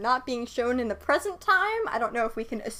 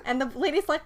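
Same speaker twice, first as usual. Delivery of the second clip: usual speaking voice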